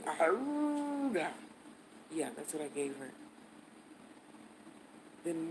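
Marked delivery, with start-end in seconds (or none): 0.0-1.3 slow stretched cartoon horn call; cartoon aruga horn. Giving the receptionist a cartoon aruga, slow horn noise. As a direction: honk it out like an old cartoon. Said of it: "You know that cartoon?".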